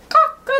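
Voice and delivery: high, loud mock rooster crow. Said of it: "Like a rooster".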